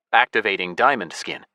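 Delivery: usual speaking voice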